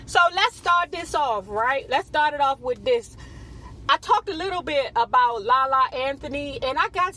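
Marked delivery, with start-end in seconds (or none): none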